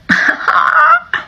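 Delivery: a sudden high laugh; high burst of laughter. Real, not acted. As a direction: let out a real laugh, high and bright. Laughs at her own joke.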